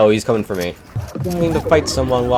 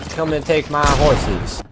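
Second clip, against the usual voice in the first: put-on playful, higher-pitched cowboy accent; 6 semitones higher than usual. Gamer narrating a gunfight as a cowboy, in a playful cowboy accent. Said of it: "I'm doing a cowboy accent".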